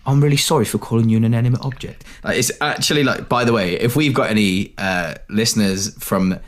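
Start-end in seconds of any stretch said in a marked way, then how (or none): 0.1-2.0 fast Cockney apology; Cockney accent. Retold or imitated. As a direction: rattle it off in Cockney. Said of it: "I really apologise for my Cockney accent".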